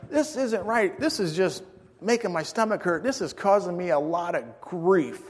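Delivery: high-pitched mock whine; acted-out aggrieved complaint; whiny complaining. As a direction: grumble about the bellyache. Complaining of stomach ache.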